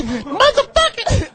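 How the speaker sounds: a high, slow, drawn-out curse; mock outburst. Host acts out cursing at the locked door, high and slow.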